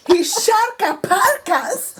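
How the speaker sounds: high, slow Scottish cartoon voice; Glomgold. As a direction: stretch it out in exaggerated Scottish.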